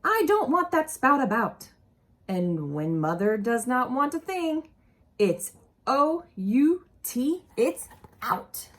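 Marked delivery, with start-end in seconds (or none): 0.0-1.7 mild mock stern mother. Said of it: "she would tell me". 5.2-7.4 O U T spelled letter by letter, slow; spelled out slowly. Shown as a demonstration. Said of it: "spelling out the word".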